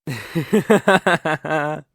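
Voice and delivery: loud, satisfied villain laugh; gloating laugh. Acted. Villain gloating as the rival sees the photos, a loud laugh. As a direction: relish their shock.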